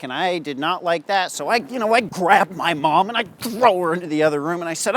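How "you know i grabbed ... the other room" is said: ordinary talk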